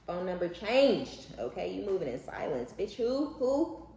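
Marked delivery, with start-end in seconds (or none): 2.8-3.9 mock dismissive. Mock response to changed number.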